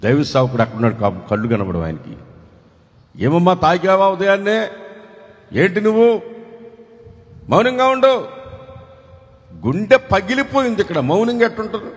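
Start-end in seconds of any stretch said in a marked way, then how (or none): 3.2-8.4 put-on a stern old priest snapping at her to hush. Blind priest scolds weeping woman. (ముసలి యాజకుడు కోపంగా గద్దించడం)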